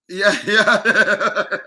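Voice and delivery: loud laughter breaking up YEAH; laughing. Real, not acted. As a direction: laugh right through the words. Laughs at end of argument.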